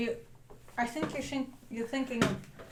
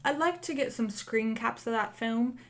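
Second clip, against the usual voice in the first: put-on chatty Cockney accent; Cockney accent, in-character interview; Cockney lamplighter; 4 dB quieter, slower than usual. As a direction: talk as a musing Cockney lamplighter.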